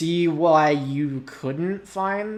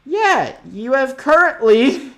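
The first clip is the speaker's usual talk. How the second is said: ordinary talk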